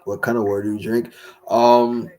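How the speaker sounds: normal talking voice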